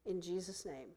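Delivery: closing prayer. Acted. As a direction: pray over them gently, letting lines fall away.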